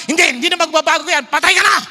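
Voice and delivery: fast, loud mock condemnation shouted out; mock harsh God. Preacher mocking a merciless God, loud fast mock shout. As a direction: bark a hammed-up death sentence. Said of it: "Hindi siya yung parang".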